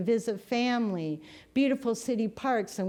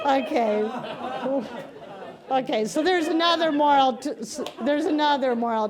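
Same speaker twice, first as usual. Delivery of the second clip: louder and higher, still laughing; 4 semitones higher, 4 dB louder than usual. Real, not acted. Preacher regains composure after laughing, still giggling. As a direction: pull yourself together through the laugh.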